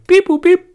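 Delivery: very high, loud bleeps; robot beeps. Pretend. Robot sound back to interview.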